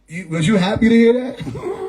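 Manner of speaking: ordinary talk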